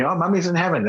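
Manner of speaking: a hint of a little boy on the phone; matter-of-fact child's answer, lightly mimicked; young son. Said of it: "And he's like".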